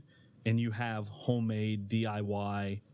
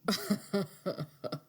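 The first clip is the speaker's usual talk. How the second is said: laughing; 10 semitones higher than usual. Real, not acted. Laughs at his own joke.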